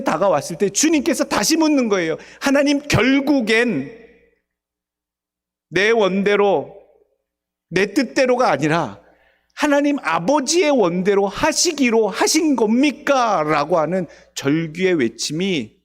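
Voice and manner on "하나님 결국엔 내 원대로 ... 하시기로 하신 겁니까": anguished plea. Acted. Jesus crying out on cross.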